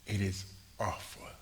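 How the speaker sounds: normal talking voice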